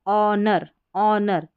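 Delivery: ऑनर said slowly, opening on "o" with no h; silent H. Shown as a demonstration. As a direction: draw out ऑनर from the vowel, h dropped. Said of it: "क्योंकि एच silent है".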